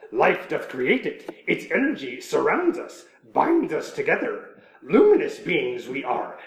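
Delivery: slow, grand Shakespearean Yoda reading; hammy stage actor. Acted. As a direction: declaim the haiku like a ham actor. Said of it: "so here's Yoda, uh, talking to Luke".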